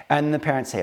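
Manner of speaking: normal talking voice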